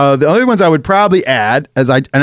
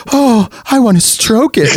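put-on slow, higher, sheepish child voice; embarrassed Tiny Tim; 7 semitones higher, slower than usual. Imitating Tiny Tim after everyone laughs, slow sheepish child. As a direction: lightly play the shamed little boy. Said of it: "He goes".

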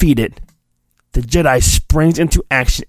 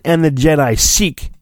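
ordinary delivery

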